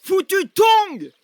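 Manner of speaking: high, loud, drawn-out curse; angry curse. Acted. Man cursing mid-chase, angry yell. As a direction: yell the curse slowly and hard.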